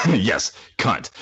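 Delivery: loud laughing echo of the swear. Real, not acted. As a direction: laugh then repeat it gleefully. Laughing agreement.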